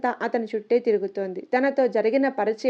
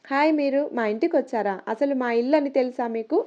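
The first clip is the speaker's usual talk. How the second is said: plain reading voice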